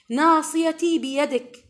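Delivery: loud, slow, pleading supplication; fervent prayer recital. Acted. Preacher reciting the grief prayer to her sisters, loud and slow. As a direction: call it out to God, drawn out and loud. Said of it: "هذا دعاء الهم والحزن".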